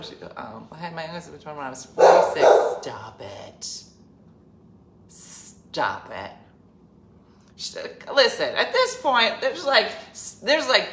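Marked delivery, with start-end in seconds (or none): none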